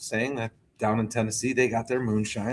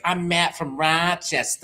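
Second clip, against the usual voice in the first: put-on slow nasal Rochester accent; drawled Rochester accent; 8 semitones higher, slower than usual. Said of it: "he'd respond with".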